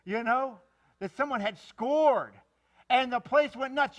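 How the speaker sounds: high, loud and fired up; rousing preacher at full volume; excited shout. Real, not acted. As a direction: keep the crowd's uproar going. Retelling the crowd's eruption.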